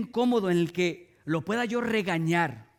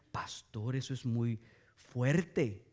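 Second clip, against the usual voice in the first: oyente escandalizado; 9 semitones lower, 6 dB quieter, much slower than usual. Retold or imitated. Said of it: "oyente objeta imitado".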